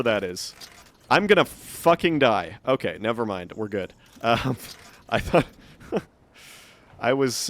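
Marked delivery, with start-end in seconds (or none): 1.1-2.6 alarmed outburst. Real, not acted. Sudden danger while playing.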